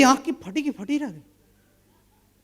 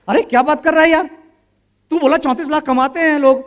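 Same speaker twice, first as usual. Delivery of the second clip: indignant protest; 5 semitones higher, 7 dB louder than usual. Retold or imitated. Friend objecting to Manish, higher and louder. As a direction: protest hotly as if wronged.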